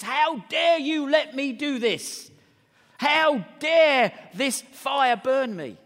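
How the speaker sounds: loud, high, drawn-out indignation; mock angry scream. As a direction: act out a child yelling at father, each word slow and heavy. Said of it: "screams at the father".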